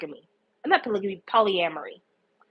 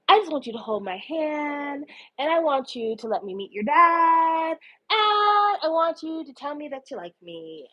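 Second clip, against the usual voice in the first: put-on needy boyfriend. Nick asking his boyfriend for affection, mock-needy pleading. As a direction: plead sweetly, stretching each last word.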